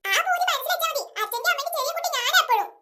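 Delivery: put-on high-pitched childish protest; playful rivalry. Child cuts in on sister over mother's affection, playful rivalry. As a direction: butt in high, claiming mother's favour.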